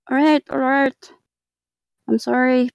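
ordinary delivery

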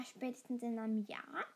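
ordinary delivery

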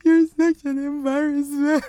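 harsh inner voice imitated; inner critic voice. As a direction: scold yourself like a nagging voice. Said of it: "I really be in my head like".

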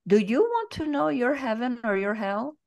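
high, swooping attempt at a Brazilian accent; butchered Brazilian accent. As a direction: quote him in a badly faked Brazilian accent. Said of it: "I'm gonna butcher his accent".

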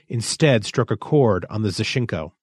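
usual speaking voice

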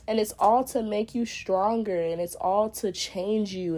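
usual speaking voice

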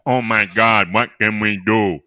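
patient's weird voice, slowed down; strange speech pattern. Acted. Patient begs the urologist for help, odd voice. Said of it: "pulling on your vocal cords and making your speech pattern weird".

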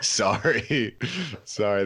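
a guilty little apology, laughing it off; sheepish laughing sorry. Retold or imitated. To his wife blaming him for the burping, sheepish laughing apology. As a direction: shrug off the blame with a laugh.

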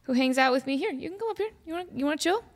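high, sweet pet talk. Real, not acted. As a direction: coax the bird up to you. Talking sweetly to pet seagull.

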